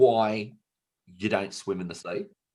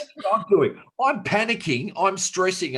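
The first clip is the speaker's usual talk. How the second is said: animated panic; 9 semitones higher, 4 dB louder than usual. Retold or imitated. Acting out own panic at fins.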